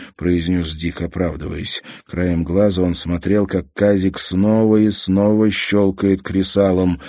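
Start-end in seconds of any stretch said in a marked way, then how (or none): none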